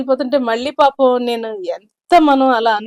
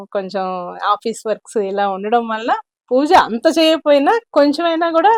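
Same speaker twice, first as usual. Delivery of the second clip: ordinary talk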